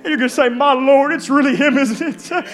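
impassioned sermon climax, building in waves; fervent preaching. Acted. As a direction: preach it with growing wonder to the congregation.

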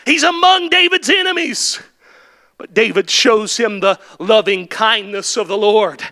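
ordinary talk, nothing marked

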